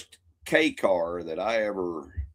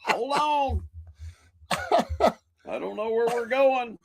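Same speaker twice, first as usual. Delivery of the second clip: high-pitched wailing cry of panic; mock panicked yell. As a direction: imitate a friend panicking, voice way up high.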